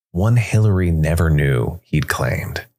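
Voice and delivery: ordinary delivery, nothing marked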